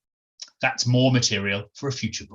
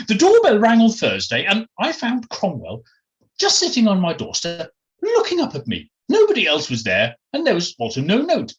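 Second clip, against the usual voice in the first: higher, animated upper-class character voice; posh Englishwoman telling a tale; 8 semitones higher, 4 dB louder than usual. Acted. Jemima telling Felicity how the dog turned up, animated posh voice. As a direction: recount it with swooping, genteel pitch.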